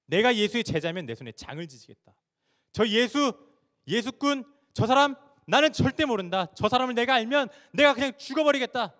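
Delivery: loud, high-pitched oath of denial; emphatic denial. Acted. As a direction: swear it forcefully, building to a shout. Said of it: "강조하면서 예수님을 모른다고 부인하는". (강하게 부인하며 맹세하는 외침)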